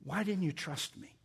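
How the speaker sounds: soft, hushed question after harsher ones; gentle reproach. Acted. Preacher voicing Jesus asking Peter about trust, gently reproachful. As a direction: drop to a soft, searching question.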